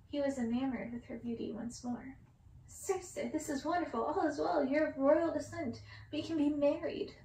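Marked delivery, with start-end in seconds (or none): 2.7-7.1 louder, higher eager plea; eager prince. Acted. Prince to Circe, eager and rising. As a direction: rush the proposal with hope.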